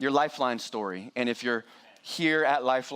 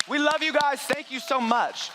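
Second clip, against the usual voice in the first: higher, brighter shout-out to viewers; enthusiastic thanks; 9 semitones higher than usual. Real, not acted. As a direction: beam it to the online guests. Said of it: "I hope they're watching online".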